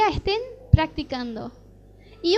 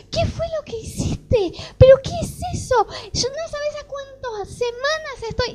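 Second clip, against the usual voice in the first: amazed exclaiming; 10 semitones higher than usual. Retold or imitated. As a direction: cry out in disbelief at the healing. Healed patient exclaiming in amazement.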